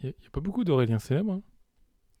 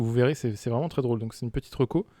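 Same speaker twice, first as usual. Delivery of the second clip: usual speaking voice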